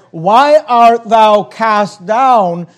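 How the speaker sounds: slow, loud, drawn-out reading of the verse; preacher intoning a psalm; declaimed scripture. Acted. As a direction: let each word ring out from the pulpit. Preacher repeats the verse slowly.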